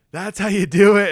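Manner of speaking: loud, high post-game cheer; mock-excited shout to the team; imitating excited coach. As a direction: burst out like a coach after a big win. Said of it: "just gets so excited".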